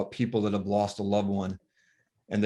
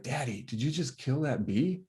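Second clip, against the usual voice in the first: dad lightly voicing his little son, a bit higher and softer; a hint of a small boy asking, hushed; young son; 5 semitones higher, 4 dB quieter than usual. Little boy asks his daddy about the bee, lightly voiced child.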